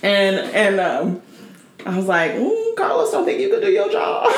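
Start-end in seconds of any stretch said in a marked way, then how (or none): 2.3-4.3 high, mock-sweet teasing with a laugh; high teasing singsong. Mocking Carlos on the call, high and giggly. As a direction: tease him like a sassy client.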